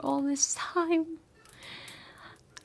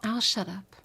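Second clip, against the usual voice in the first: ordinary talk, nothing marked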